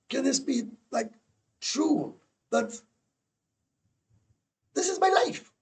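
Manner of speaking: high, amazed inner voice, slowing down; high, disbelieving inner thought; incredulous inner voice. Retold or imitated. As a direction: marvel at your own luck. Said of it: "I'm thinking to myself".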